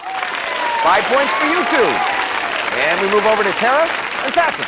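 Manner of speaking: drawn-out host announcement; slow, showy points call; game show host. Acted.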